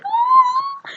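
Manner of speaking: long, very high cooing squeal; high crowd "aww". Retold or imitated. Venue crowd reacts to the friends meeting, high cooing squeal. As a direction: imitate a crowd going aww.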